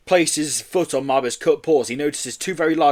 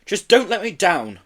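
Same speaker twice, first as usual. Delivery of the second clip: higher-pitched, insistent order; firm order; 6 semitones higher, 3 dB louder than usual. A narrator's reading. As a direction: lay down the law, mildly. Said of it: "Joe ordered".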